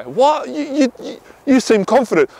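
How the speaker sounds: high-pitched stammering disbelief; astonished reply. Acted. Woman reacting to his admission of shyness, astonished. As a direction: act taken aback, tripping upward over the words.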